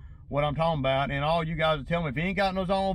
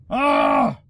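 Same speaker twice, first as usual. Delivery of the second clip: exasperated shout; 7 semitones higher, 9 dB louder than usual. Real, not acted. Exasperated outburst while waiting.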